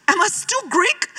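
high, slow, rising disbelief; stunned blurted question. Retold or imitated. To her mother after the adoption news, high stunned question. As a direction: squeak it out like the floor just dropped away. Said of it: "I went".

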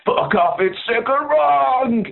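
high, drawn-out mock yell on the last words; rising mock holler; imitated bloke's shout. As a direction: yell it like the bloke in a rock intro, stretching the end. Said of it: "then some bloke goes".

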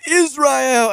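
hammed-up pageant contestant introduction, pitched high; mock pageant self-introduction, high and grand; bombastic announcing. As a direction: belt out the country name, vowels stretched. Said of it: "they're supposed to be very bombastic".

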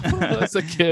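normal talking voice

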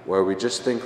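normal talking voice

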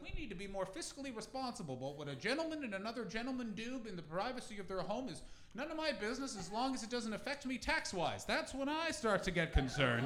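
put-on voice of a concerned mom; imitating mom. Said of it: "starting with my mom".

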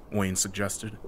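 plain reading voice

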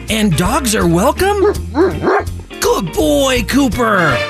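high sing-song dog-talk voice. Acted.

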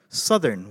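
"Southern" said suh-thern with a short "uh"; the American correction "Southern", suh-thern; American way of saying it. Retold or imitated. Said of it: "So they go".

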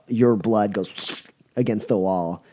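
ordinary delivery

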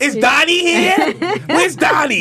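put-on high, slow, laughing Boston accent. Asking for Donnie in a joke Boston accent. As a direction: call out like a Boston fan at Wahlburgers. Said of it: "I feel like I'm at Wahlburgers".

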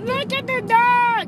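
lightly voiced toddler pointing at the dog; slow, a little high, babyish. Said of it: "Try to talk like a baby".

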